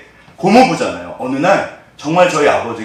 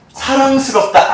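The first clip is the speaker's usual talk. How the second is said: 사랑스럽다 sung up high and slow; 사랑스럽다 drawn out high and slow; sweetly cooing; 11 semitones higher, 4 dB louder, slower than usual. Shown as a demonstration. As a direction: coo 사랑스럽다 like over a baby. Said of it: "엄청나게 사랑스럽다".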